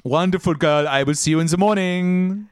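put-on high, loud goodnight in a vague German or French accent; captain bids her second mate goodnight, high and loud; captain Gruner. High, loud goodnight in a vague German or French accent.

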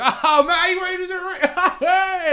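high, slow, mocking film-villain whine; film baddie. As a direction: sneer it like a movie baddie. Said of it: "baddies in films always".

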